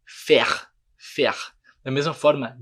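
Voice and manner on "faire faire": "faire" as fehr, last sound the throaty R; one-syllable "faire" with guttural ending; ends on R. Shown as a demonstration. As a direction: finish "faire" on R, no E. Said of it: "é do R".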